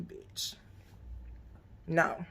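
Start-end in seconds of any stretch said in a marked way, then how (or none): 0.0-0.5 under-the-breath bitch; hissed aside, almost no voice; whispered jab. Real, not acted. Whispering a jab at Egypt from the show.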